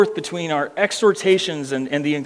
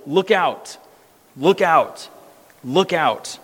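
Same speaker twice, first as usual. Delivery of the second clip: each look out falling and fading; threefold warning; slower than usual. Acted. Preacher repeating the warning to the church, fading. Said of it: "purposefully stated three times".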